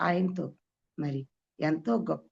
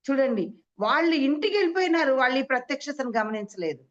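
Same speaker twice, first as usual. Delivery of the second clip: preaching exclamation; 7 semitones higher, 4 dB louder than usual. Acted.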